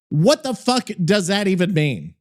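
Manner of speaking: usual speaking voice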